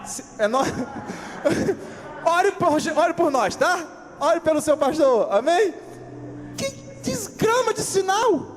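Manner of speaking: usual speaking voice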